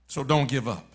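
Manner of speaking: usual speaking voice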